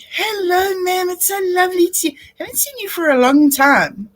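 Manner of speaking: a hint of an overjoyed attendant; gushing attendant. Attendant voiced in story.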